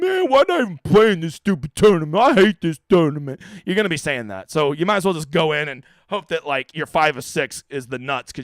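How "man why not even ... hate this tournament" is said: mock griping. Host mimicking viewers sick of the tournament, mock whining. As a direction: imitate a fed-up player moaning, dragging the words out. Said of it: "you're gonna be like".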